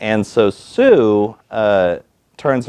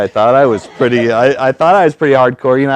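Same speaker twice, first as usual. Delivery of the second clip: ordinary talk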